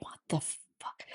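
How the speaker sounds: breathless, horrified whisper; stunned whisper. Retold or imitated. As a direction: barely breathe the words out in shock. Retold reaction to brother's death.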